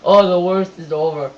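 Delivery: loud, off-voice mock action-hero line; loud mock action-star voice; Arnold Schwarzenegger. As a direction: imitate a muscleman actor's calm reassurance. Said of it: "Arnold Schwarzenegger is going".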